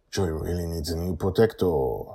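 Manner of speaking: ordinary delivery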